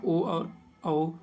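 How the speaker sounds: slow, careful "ओ और औ" with the mouth open wide; धीरे-धीरे स्वर उच्चारण; vowels spelled slowly. Shown as a demonstration. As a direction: sound out "ओ और औ" one at a time, slowly. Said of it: "मुंह अधिक खुला हो".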